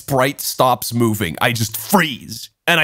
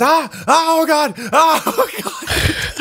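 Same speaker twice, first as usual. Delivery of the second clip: high-pitched, hammed-up yelps; mock panic; 13 semitones higher, slower than usual. Hearing they'll all tell his girlfriend, mock panicked yelps. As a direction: yelp in comic panic, breaking into laughter.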